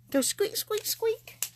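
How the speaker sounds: high, playful squeaks; playful squeak noises. Pretend. Crafter playfully squeaking while pressing the droplets, high and silly. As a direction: squeak it out like a toy.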